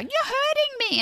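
put-on high, whiny kid voice; whiny child. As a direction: whine it like a small child. Child yanking her hand away.

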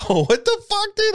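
high startled imitation; mock shocked. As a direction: blurt it out in hammed-up shock. Reacting to seeing her.

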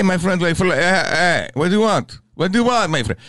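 lightly hammed-up Middle Eastern accent; mock Middle Eastern accent, asking what you want. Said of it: "Middle Eastern accent".

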